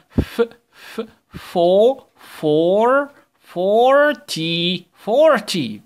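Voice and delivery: slow drawn-out fo-r-ty, each sound stretched; sounding out forty. Shown as a demonstration. Teacher sounding out forty slowly for young pupils. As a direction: build the word sound by sound for the class. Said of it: "شوف بقك عامل شكله إزاي مدور".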